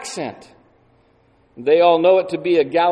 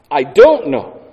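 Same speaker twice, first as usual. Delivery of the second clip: "I don't know" louder, "don't" punched and falling; emphatic denial; 2 semitones lower, 7 dB louder than usual. Shown as a demonstration. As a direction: hit "don't" hard in "I don't know". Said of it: "the emphasis grows".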